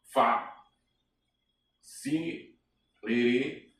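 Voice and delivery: Fa, Si, Riri spelled out in separate beats; syllable by syllable. Shown as a demonstration. Teacher splitting the word into syllables for the class. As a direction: break Facilité into syllables. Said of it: "Facilité".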